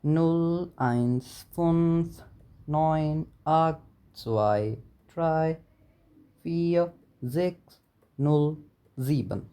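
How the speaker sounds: slow careful repeat for learners to write down. Shown as a demonstration. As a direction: dictate the number carefully, digit by digit. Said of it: "मैं थोड़ा slow बोलता हूँ".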